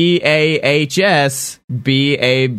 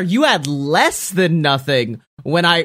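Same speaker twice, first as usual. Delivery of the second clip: ordinary delivery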